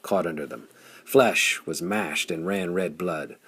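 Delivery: ordinary delivery, nothing marked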